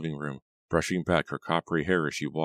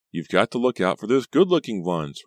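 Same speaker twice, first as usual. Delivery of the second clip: louder, higher taunt; cruel; 5 semitones higher, 7 dB louder than usual. A narrator's reading. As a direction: needle her with a mildly cruel lilt. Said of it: "Reva said cruelly".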